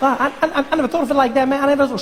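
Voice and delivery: put-on high young Obama.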